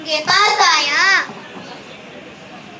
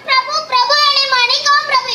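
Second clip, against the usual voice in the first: hammed-up high grovelling plea, fast; a gardener rehearsing a whiny plea for mercy, higher and quick; mock begging; 6 semitones higher, faster than usual. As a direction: throw yourself at the king's feet in jest. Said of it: "கெஞ்சி கேட்கணுமா".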